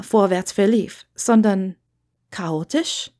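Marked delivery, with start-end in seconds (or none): none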